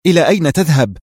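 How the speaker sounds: edgy, nervous irritation. A narrator's reading. Sherif questions Alaa as he leaves, edgy. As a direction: demand an answer, mildly on edge. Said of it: "سأله شريف في عصبية".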